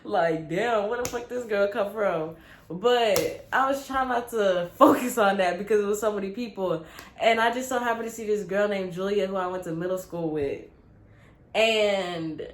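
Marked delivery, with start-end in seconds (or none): none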